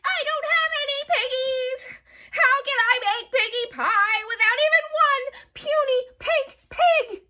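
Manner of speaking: high, loud wailing hag; screeching witch. A narrator's reading. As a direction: wail it like a frantic witch. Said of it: "screeched Gritch".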